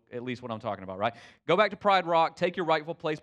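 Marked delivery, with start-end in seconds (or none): 1.5-3.2 loud, raised urging call; mock rallying challenge; Nala's challenge. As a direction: push him to go back, louder. Nala challenging Simba to return.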